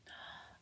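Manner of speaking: quiet sharp gasp of surprise. Pretend. Teacher gasps at the alligator picture. Reveal of creature.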